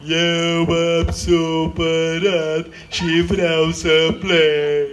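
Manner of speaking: slow, thick, strange string voice; offended string speaking thick and odd; thicker, odd voice. Acted. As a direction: sulk it out as an upset string. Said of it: "mai gros și mai ciudat".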